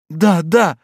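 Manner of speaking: high, loud eager cry. A narrator's reading. Batilda desperate for news of Raoul, crying out. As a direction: burst out in agreement. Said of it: "вскрикнула Батильда".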